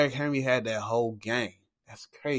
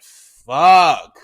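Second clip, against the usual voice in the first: reenacted curse; 9 dB louder than usual. Dejected after pick.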